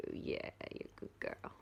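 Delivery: soft whispered praise; whisper to dog. Real, not acted. Owner whispering praise to her dog.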